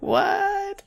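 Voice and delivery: very high squeaked disbelief; baffled what. Real, not acted. Host reacts to the absurd plot twist, very high baffled squeak.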